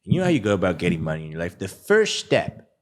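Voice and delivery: low, punchy sales-ad pitch. Acted. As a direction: sell it to camera like a guru ad. Ad pitch on camera.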